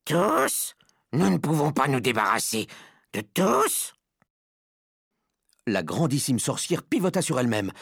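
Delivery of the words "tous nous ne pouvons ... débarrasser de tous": high, incredulous squeal; witch speaking out. A narrator's reading. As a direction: protest aloud in a high, disbelieving witch voice. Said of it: "dit à haute voix".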